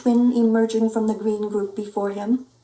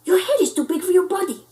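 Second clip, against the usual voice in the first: high, louder, fast retort; excited retort; 9 semitones higher, 4 dB louder, faster than usual. Acted. Pup retorting to the Martian, fast and high. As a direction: fire back quickly.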